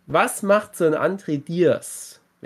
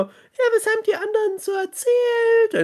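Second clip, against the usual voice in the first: very high, far-off wife voice; squeaky wife impression. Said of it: "fragt mich ja meine liebe Frau".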